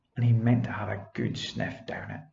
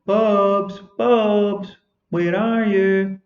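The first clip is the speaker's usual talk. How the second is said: loud, higher call across the field; 8 semitones higher, 8 dB louder, much slower than usual. Acted. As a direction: call the lost dog from afar. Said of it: "Benny calling him".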